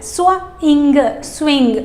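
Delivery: "स्विंग" drawn out slowly, s and w joined; slow careful word. Shown as a demonstration. Teacher modelling swing slowly. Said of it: "तो स्विंग बोलना है".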